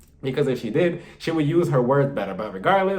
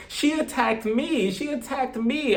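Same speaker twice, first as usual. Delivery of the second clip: higher, slow whimpering fake cry; mock tearful; 9 semitones higher, slower than usual. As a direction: play the wounded victim with fake tears.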